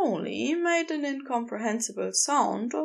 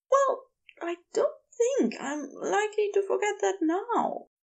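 high-pitched character voice, earnest reply; high, sincere character reply; Aziraphale, earnest; 10 semitones higher than usual. Acted. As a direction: answer earnestly in a high, careful voice.

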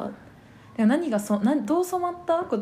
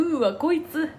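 higher-pitched put-off reaction; mock disdain; 7 semitones higher than usual. Imitating friends hearing her say coffee, mock disdain. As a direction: recoil as if she is showing off.

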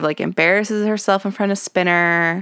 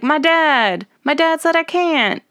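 put-on high whiny girl voice, higher; Terry impression; 9 semitones higher than usual. Imitating Terry telling Ashley she can't go, high and whiny. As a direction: whine it like a sad teen. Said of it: "And Terry's like".

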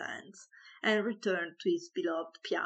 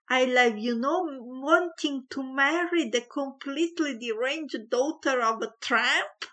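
loud, higher angry tirade; furious rant; 6 semitones higher, 5 dB louder than usual. A narrator's reading. Father ranting at the maid about his son's bride, loud and angry. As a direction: keep raging at the maid.

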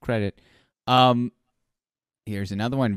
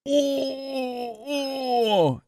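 stunned cry. Real, not acted. Reacting to a wild emote.

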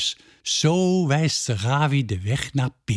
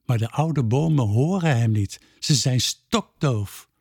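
usual speaking voice

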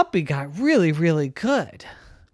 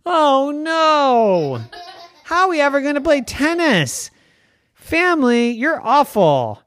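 despairing cry; 11 semitones higher, 6 dB louder than usual. Acted. Puppy despairs.

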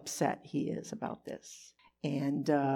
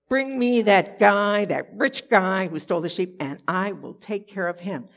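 angry king; 7 dB louder than usual. Acted. King David hearing the parable, loud angry demand.

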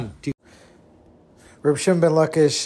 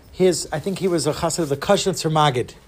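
normal talking voice

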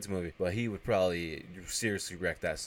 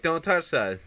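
high, loud, quick warning; mock alarm; imitated alarmed warning; 10 semitones higher, 7 dB louder, slower than usual. Elves warning as the lever gets pressed, alarmed.

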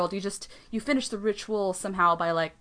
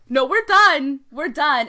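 louder, higher imitated refusal; firm dismissal; 5 semitones higher, 8 dB louder, slower than usual. Goddess refusing the mirror exercise, imitated as firm dismissal. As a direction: cut the talk off emphatically, as a retold quote. Acting Morgan's refusal of further talk.

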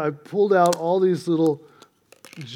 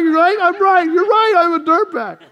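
much higher and louder with each repeat; rising preaching excitement; 9 semitones higher, 4 dB louder than usual. Real, not acted. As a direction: build it up, climbing with every right.

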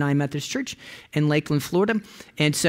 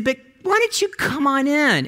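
urging call; 9 semitones higher than usual. Retold or imitated. Urging friend to come in.

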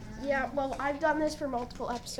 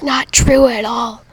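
loud denial; 15 dB louder, slower than usual. Pretend.

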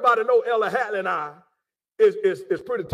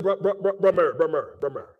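lightly imitated country accent, pitched lower; 5 semitones lower, faster than usual. Hatley to brother Murray on the phone, imitated country accent. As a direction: quote him in a low country voice. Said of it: "that country accent".

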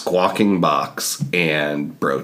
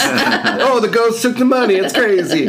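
high playful voice; 4 dB louder than usual. Pretend. Mock ghost claim while laughing.

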